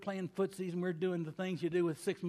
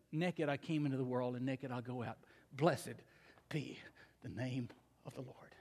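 low, soft, fading to a whisper; hushed reverent quote; 8 semitones lower, 7 dB quieter than usual. Acted. Pastor quoting Job to his congregation, hushed and reverent. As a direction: let Job's words sink into a breath.

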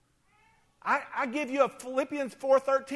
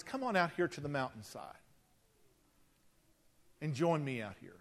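gentle invitation; 6 semitones lower, 8 dB quieter than usual. Retold or imitated. God gently invites Elijah.

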